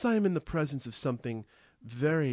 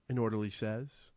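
ordinary delivery, nothing marked

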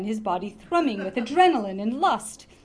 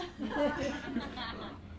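laughing; 4 semitones higher, 8 dB quieter than usual. Real, not acted. Reader laughs after the line.

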